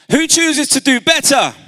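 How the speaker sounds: high, loud challenge to the crowd; rallying shout. Real, not acted. Speaker challenging the audience to respond, rousing shout. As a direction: shout the question to the whole hall.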